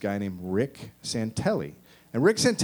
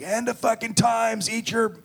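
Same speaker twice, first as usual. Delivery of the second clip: mock doomsday preacher; 8 semitones higher than usual. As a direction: preach end-times doom. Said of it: "this reverend who got on the radio".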